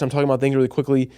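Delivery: rushed, rattled-off speed demo; super fast. Shown as a demonstration. Showing viewers how speaking too fast loses them, rushed. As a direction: race through it so it's hard to follow. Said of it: "If I speak super fast".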